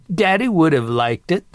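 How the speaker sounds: chuckling. A narrator's reading. Grandmother defending her crude joke to sister, chuckling. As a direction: say it tickled, a chuckle following. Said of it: "Martha said and chuckled".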